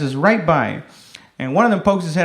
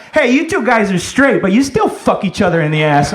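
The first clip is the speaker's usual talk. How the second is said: mock yelled taunt; 3 semitones higher than usual. Comic suggesting what the kid should have said, mock yell. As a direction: mildly call it out as an insult. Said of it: "he should have yelled out".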